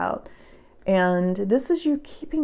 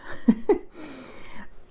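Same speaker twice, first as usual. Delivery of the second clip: breathy giggle; a real burst of laughter; an unvoiced laugh slipping out; 5 semitones higher than usual. Real, not acted. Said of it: "I'm sorry I'm laughing".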